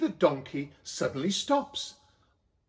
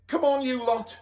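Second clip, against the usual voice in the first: higher, hurrying voice; urging donkey man; 7 semitones higher than usual. A narrator's reading. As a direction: hurry them in. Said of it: "said Donkeyman".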